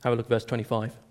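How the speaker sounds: ordinary talk, nothing marked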